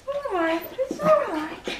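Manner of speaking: soft, high-pitched reassurance; soothing. Real, not acted. Soothing a scared puppy.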